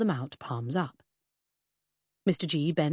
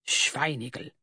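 hissed insult; 2 semitones lower than usual. A narrator's reading. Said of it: "the Prussian hissed".

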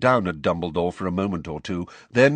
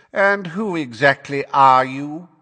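loud, raised, haughty drawl; bored and lofty; 7 semitones higher, 7 dB louder than usual. Acted. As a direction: ask it as if far above them. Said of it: "in a bored and lofty voice".

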